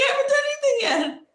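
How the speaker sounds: very high, loud protest; disbelieving squeal; incredulous. Retold or imitated. Insisting she hasn't done anything yet, high incredulous.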